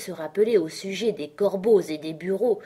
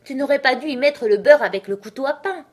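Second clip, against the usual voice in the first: loud, high scolding; grumbling reproach; 8 semitones higher, 9 dB louder than usual. A narrator's reading. Hatter blames the March Hare, loudly reproachful. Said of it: "grommela le chapelier".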